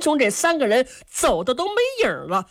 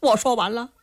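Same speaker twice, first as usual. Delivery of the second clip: ordinary delivery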